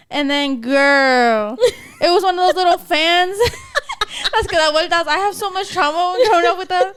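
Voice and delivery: usual speaking voice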